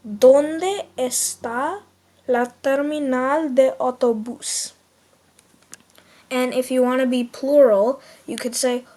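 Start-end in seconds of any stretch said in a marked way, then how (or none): 0.1-4.7 very slow, careful Spanish; drawn out for the class to repeat; teacher slowly repeating the Spanish. Shown as a demonstration. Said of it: "let's say it again".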